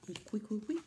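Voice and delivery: ordinary talk, nothing marked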